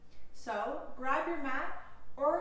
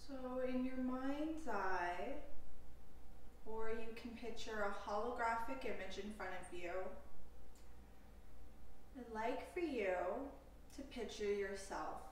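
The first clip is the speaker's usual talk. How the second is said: slow, calm meditation voice with long pauses; guided meditation; 3 semitones lower, 4 dB quieter than usual. Acted. Yoga teacher leading listeners through a compassion meditation, calm guided delivery. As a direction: guide them unhurriedly into stillness, leaving space between phrases.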